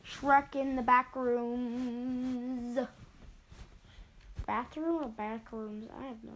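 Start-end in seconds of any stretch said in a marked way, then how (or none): 0.0-2.9 slow, drawn-out movie trailer voice; dramatic title announcement. Shown as a demonstration. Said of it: "so I'm gonna play Shrek".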